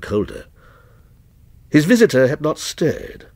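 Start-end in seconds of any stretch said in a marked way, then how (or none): none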